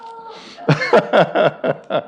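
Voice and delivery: speaker laughs at the punchline; real laugh. Real, not acted. Speaker laughs at own joke.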